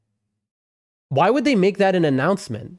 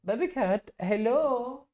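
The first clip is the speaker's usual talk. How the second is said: soft, higher cooing, almost breathed; 6 semitones higher, 12 dB quieter than usual. Real, not acted. Streamer coaxes his cat onto him, soft high cooing. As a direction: coo to a kitten you adore.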